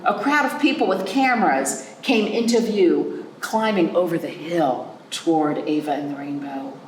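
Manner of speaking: usual speaking voice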